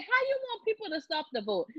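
high, fast, half-laughing disbelief; incredulous mock question. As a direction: toss it back at him, high and quick. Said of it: "I'm like".

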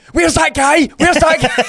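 high, loud imitation of kids yelling across the house; kids shouting. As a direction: shout it like a kid hunting for someone. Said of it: "they were shouting to everybody".

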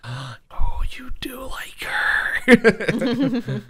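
drawn-out stage whisper; gasping teasing whisper. Retold or imitated. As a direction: whisper it slowly, like a juicy discovery. Wendy's gasping realization, acted out.

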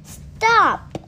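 high loud shout; sudden shouted command; shouted stop. A narrator's reading. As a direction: yell it to halt them. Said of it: "He leaped out and shouted".

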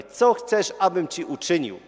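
high, loud, ringing question, falling at the end; emphatic question. Retold or imitated. Jesus questions the blind beggar, loud and emphatic. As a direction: put the question forcefully, as Jesus.

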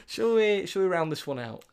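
ordinary delivery

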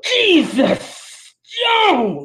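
slow, exaggerated shout-out; hype announcer. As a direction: stretch the name out like a hype drop. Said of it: "I like that better than your radio voice".

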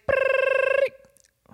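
high trilled phone ring; imitated ringing. Imitating the elevator speaker ringing, high trill. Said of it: "the speaker starts ringing".

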